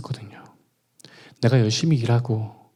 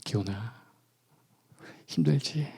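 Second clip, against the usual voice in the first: much softer, tender call; barely above a breath, comforting; God's gentle voice; 3 semitones lower, 11 dB quieter than usual. Retold or imitated. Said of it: "하나님이 또다시 찾아오시는 거예요".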